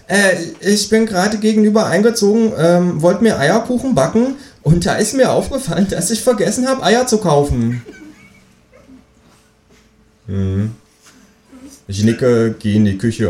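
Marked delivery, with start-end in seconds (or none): 0.1-7.8 high-pitched, chatty kangaroo. A narrator's reading. Kangaroo asks new neighbour for eggs, high voice.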